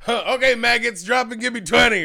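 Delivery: loud barking drill sergeant. Retold or imitated. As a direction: shout it like a boot camp sergeant. Mock drill sergeant quote.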